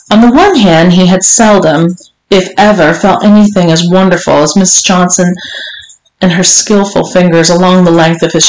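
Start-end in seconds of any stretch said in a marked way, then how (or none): none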